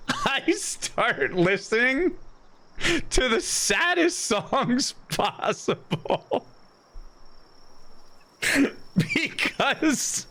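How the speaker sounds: high, slow words broken by real laughter; cracking up at yourself, high and drawn out; laughing through words. Real, not acted. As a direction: giggle your way through it, pitch way up. Laughs at own sad-song coping.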